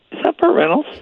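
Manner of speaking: high, disbelieving question; incredulous. Retold or imitated. Recalling the uncanny Burt Reynolds impression, high and disbelieving. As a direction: sound amazed it could be him. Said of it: "He did a great Burt Reynolds".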